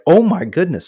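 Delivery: high, loud gasp of relief; retelling relief when the card company steps in; amazed relief. Retold or imitated. Retold relief at card company help.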